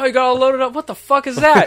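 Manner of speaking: a hammed-up gasp of disbelief; mock shock. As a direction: play the driver gawking at the load. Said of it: "he's like".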